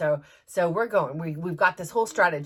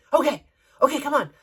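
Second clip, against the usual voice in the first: higher, louder urging; imitated hurrying; 6 semitones higher, 5 dB louder, faster than usual. Gate man rushing a late passenger, urgent. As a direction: hustle them along, pitched up.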